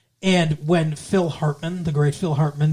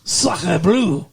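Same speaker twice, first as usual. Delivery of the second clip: mock French accent. Mock French exclamation after accent joke.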